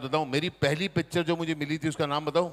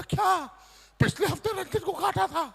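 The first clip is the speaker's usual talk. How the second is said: much higher alarmed squeak; shocked; 13 semitones higher than usual. Retold or imitated. Guest asks in high-pitched shock whether dog bit. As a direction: gasp it out in disbelief.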